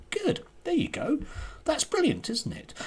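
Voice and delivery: high, mock-delighted approval; mock applause for a forced apology; sarcastic praise.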